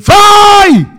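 high, loud anguished wail; Pharaoh's wail. Acted. Pharaoh crying out over his lost people, loud wail. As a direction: wail like a king who lost everything. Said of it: "אומר פרעה".

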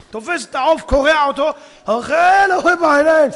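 loud, high, rising excitement; ravenous relish. Acted. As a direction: build to a shout of gluttonous delight. Acting out ravenous eating. (התלהבות קולנית של זללן)

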